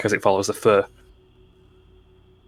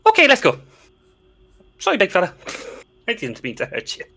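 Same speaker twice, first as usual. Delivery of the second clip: halfling voice; 9 semitones higher, much faster than usual. Acted.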